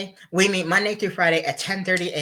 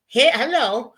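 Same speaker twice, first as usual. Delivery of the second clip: high, louder, showy hello; playful hey; 8 semitones higher, 5 dB louder than usual. Pretend. Vlogger jokingly pointing viewers to himself, playfully loud. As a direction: play it up like a show-off.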